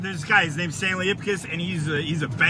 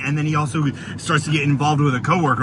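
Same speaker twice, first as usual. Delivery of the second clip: ordinary talk, nothing marked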